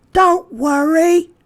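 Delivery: put-on high, slow, loud boy voice; a boy comforting his scared friend, high and loud; Chester's voice. A narrator's reading. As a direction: reassure a friend in a high child voice. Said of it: "said Chester".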